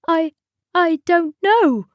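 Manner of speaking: high, halting stammer. A narrator's reading. Customer answering the angry tea lady, stammering. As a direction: stammer with a break after the first word. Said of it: "stammered the man".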